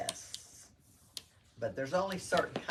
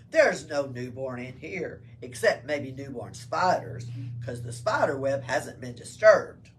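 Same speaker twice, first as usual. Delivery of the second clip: gruff soldier; 3 semitones lower, 9 dB louder than usual. Acted. Soldier searching cave, voiced.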